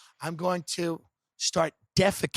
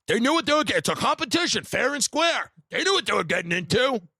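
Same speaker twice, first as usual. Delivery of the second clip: smug defender; 7 semitones higher, 3 dB louder than usual. Retold or imitated. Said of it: "They'll go".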